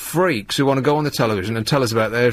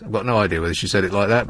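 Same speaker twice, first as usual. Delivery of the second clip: normal talking voice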